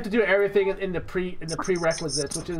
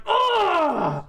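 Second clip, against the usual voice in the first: high, drawn-out "ugh"; exaggerated yelp at being hit; mock pained cry; 10 semitones higher than usual. Husband smacked by his wife in a restaurant, mock pained cry. Mimics reaction to wife's smack.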